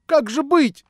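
high, loud burst of alarm; frightened cry. A narrator's reading. Natasha alarmed at her predicament, frightened cry. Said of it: "вскричала она, испуганная".

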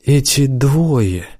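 ordinary delivery

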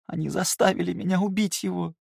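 high tearful cry; sobbing. Acted. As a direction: choke it out through tears. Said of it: "захлёбываясь слезами".

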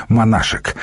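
usual speaking voice

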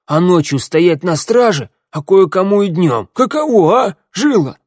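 loud, high-pitched outraged griping; indignant rant. A narrator's reading. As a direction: complain at full voice, pitch leaping up. Косой rants on to his mate.

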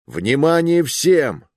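медленный громкий командный оклик; крикни на весь отряд. Acted. (громко, по-командирски)

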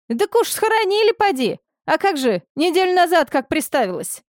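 high, smug village gossip. A narrator's reading. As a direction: share news with smug relish. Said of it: "С удовлетворением знающего информацию человека".

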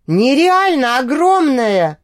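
slow, outraged exclamation; indignant cry. A narrator's reading. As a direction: cry out in disgust. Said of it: "вскричал Ревн".

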